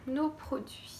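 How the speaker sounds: breathy whisper to herself; soft private whisper while opening the box; whispered aside. Real, not acted. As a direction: whisper it as if only to yourself. Muttering to herself while unpacking.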